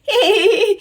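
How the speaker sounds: high, bright burst of laughter; delighted laugh. Acted. Mother laughing at her giggling baby.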